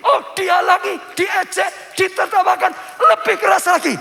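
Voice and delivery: high, very loud preaching shout; full-force shouting. Shown as a demonstration. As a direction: drive each phrase home at the top of the voice. Preacher shouts to illustrate louder crying. (berkhotbah sambil berteriak sekuat tenaga)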